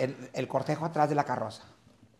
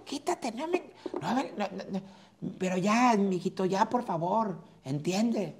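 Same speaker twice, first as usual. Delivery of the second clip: put-on high, frantic mother pushing the child away; grieving mother; 10 semitones higher than usual. Widow at the burial fending off the boy, high and frantic. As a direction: play a distraught widow snapping at the boy. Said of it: "Una señora lo levanta y dice".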